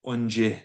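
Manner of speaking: Onje said slowly and carefully, on-jeh; pronunciation demo. Shown as a demonstration. Teacher models the word Onje. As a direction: sound out Onje for the learners. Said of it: "relative root on the front".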